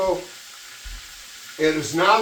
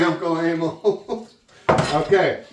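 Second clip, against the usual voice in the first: usual speaking voice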